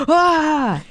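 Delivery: high startled yelp; startled alien shriek. Pretend. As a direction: shriek at a giant roach. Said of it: "a roach, and they're like".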